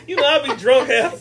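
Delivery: loud, laughing and slow. Real, not acted. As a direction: laugh your way through the words. Laughing confession.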